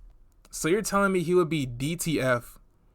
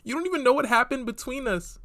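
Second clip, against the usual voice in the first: put-on higher, prissy voice of the offended girl; higher mock-hurt girl voice; mocking imitation; 8 semitones higher than usual. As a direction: read her texts like she's clutching pearls. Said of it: "now you're talking about".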